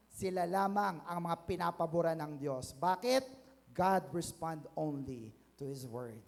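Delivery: normal talking voice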